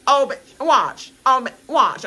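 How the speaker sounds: hammed-up threat; mock taunt. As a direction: warn them with relish. Acting the taunting contestant.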